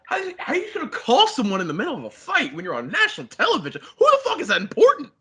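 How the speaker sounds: high, fast exasperated rant; incredulous indignation. Real, not acted. Friend ranting about a phone call mid-fight, indignant and high. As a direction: pitch it way up, rapid and appalled.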